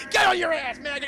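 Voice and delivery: high, loud bellowing command; drill sergeant bark. Retold or imitated. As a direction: yell it down at a recruit. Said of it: "Cruel Drill Sergeant".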